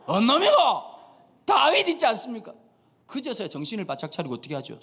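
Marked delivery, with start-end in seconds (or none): 0.1-0.5 loud, high Gyeongsang demand; a king's sharp question in Gyeongsang dialect; Gyeongsang accent. Acted. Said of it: "경상도 말로". 1.4-2.5 high, loud Gyeongsang reply; Gyeongsang accent. Acted. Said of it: "경상도 말로".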